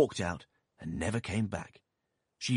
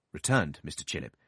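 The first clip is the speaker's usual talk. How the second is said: ordinary delivery, nothing marked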